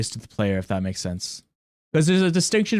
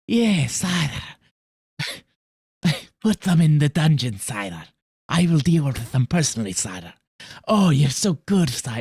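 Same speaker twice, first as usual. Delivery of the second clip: fawning serpent advisor voice; snake voice; 2 semitones higher than usual. Retold or imitated. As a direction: grovel like a slippery snake. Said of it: "It has a snake voice".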